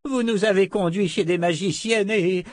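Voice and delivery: put-on high-pitched old man's protest; squealing protest. Ruben keeps squealing in protest. (protestation aiguë d'un vieil homme)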